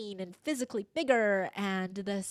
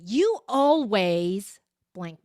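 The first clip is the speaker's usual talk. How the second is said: accusing tone; 8 dB louder, much slower than usual. Retold or imitated. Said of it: "in that tone of voice".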